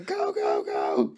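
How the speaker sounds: shouting go. Pretend. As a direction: yell it like a starter. Joining the commander game.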